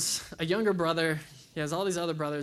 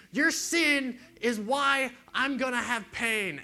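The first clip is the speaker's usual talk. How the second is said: slow, accusing, higher and louder; mock accusing quote; 7 semitones higher, 5 dB louder, slower than usual. Jesus imagined blaming the crowd, mock accusing. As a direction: lay the blame on the crowd, slow.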